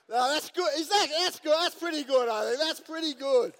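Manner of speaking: high, loud and tickled by the joke; loud, high-pitched delight after a big laugh; amused self-approval. Real, not acted. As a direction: crow over your joke, on the edge of laughter. Cracking up at own joke's reception.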